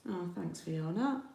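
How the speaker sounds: normal talking voice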